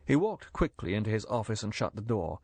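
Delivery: usual speaking voice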